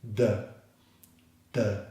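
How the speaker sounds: "The" said as a short, low "duh"; voiced D. Shown as a demonstration. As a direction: release a voiced D with a brief neutral vowel. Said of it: "there should be some vibration".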